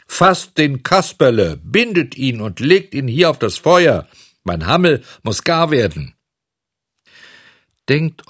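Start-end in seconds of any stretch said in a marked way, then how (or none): 0.1-6.1 loud, high, imperious order; commanding director. A narrator's reading. Director ordering his men to seize a prisoner, commanding. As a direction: proclaim the order like a stern master.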